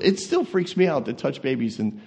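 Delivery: normal talking voice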